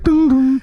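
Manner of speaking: high two-note "ding, ding"; sung imitation of an announcement bell; tannoy chime.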